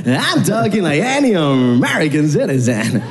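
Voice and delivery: slow, drawled-out fake American accent; hammed-up American, enunciating each word; exaggerated American accent. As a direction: stretch every word in overdone American. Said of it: "why are you talking like that?".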